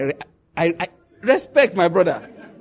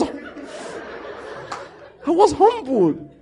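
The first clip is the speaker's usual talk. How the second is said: normal talking voice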